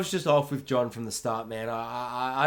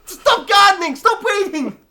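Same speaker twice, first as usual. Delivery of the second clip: much higher, much louder shouted warning; frantic shout; 14 dB louder than usual. Retold or imitated. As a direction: scream at the gardener to run. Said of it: "I'm like".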